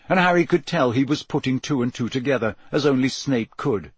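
plain reading voice

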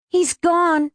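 put-on high, urgent young man's cry; high-pitched anxious call; urgent repeating. A narrator's reading. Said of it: "Benji kept saying".

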